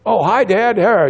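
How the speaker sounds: high, loud mock child reply; kids' sudden greeting. TV-zombie kids suddenly noticing dad, mock greeting.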